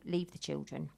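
normal talking voice

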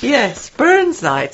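high, slow mock Scottish; bad Scottish accent. Shown as a demonstration. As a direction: stretch it out in a put-on Scottish accent. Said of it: "do my Scottish accent again".

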